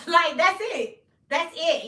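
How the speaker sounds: high, loud laughing declaration; loud high laugh-shout, it's over. Real, not acted. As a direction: crack up while calling it over. Laughing at the brother's verdict.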